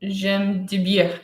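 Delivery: slow careful "J'aime de bière"; teacher saying "J'aime de bière" slowly; French phrase, slow. Shown as a demonstration. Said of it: "And now say".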